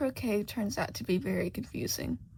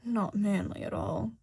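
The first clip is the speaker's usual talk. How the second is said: muttering king. Acted. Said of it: "he mutters".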